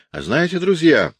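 high, bright-spirited teasing call; a merry voice from the crowd, pitched high; cheerful young voice. A narrator's reading. Young onlooker teasing the crowd, cheerfully. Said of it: "раздался весёлый молодой голос".

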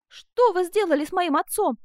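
loud, high accusing cry; alarmed demand. A narrator's reading. Daughter's alarmed demand for her father.

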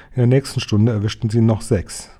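ordinary delivery, nothing marked

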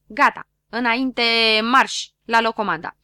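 loud marching order; sudden command. Acted. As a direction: shout it out of nowhere. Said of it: "strigă el pe neașteptate". (comandă strigată pe neașteptate, tare)

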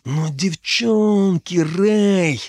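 high, very drawn-out, suggestive words; teasing. Acted. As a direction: stretch each word to tease a slow friend. Дарт с намёком дразнит друга. (дразняще, нараспев, высоким голосом)

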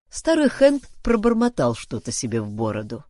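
usual speaking voice

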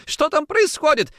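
alarmed cry. A narrator's reading. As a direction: call out in panic from another room. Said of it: "закричала жена из коридора".

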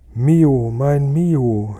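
father calling after his son, loud. A narrator's reading. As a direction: cry out after the leaving son. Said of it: "noch rufen".

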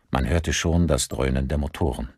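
ordinary delivery

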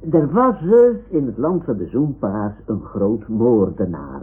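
usual speaking voice

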